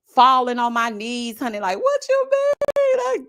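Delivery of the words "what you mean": drawn-out wail, far higher and very slow; mock sobbing plea. Vlogger acts out being dumped, wailing slow and high. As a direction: stretch it into a heartbroken wail. Acting out falling to her knees.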